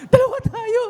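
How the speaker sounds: high, half-laughing sob voice; mock tearful comfort. Sister crying along with the brother, hammed-up teary voice. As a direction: comfort him while pretending to cry too. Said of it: "umiyak din".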